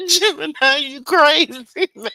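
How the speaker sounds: words pushed out between laughs; laughing hard. Real, not acted. Laughing hard at Gemini's comment, calling him crazy. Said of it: "I'm laughing so hard".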